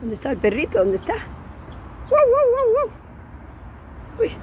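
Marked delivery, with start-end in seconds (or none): 2.1-2.9 high barking woof woof; dog bark imitation.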